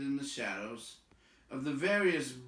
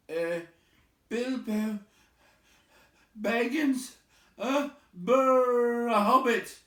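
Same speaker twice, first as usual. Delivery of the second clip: high, loud stammering hobbit; frightened hobbit; 13 semitones higher, 11 dB louder, slower than usual. Acted. Bilbo terrified answering the trolls, stammering. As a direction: stammer out his name in fright. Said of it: "shaking all over".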